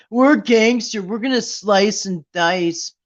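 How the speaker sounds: high, slow mock tough-guy threat; mock gangster talk. Mocking online trolls' threats, high, slow mock tough guy. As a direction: ham up the keyboard thug. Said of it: "you think they're talking like this".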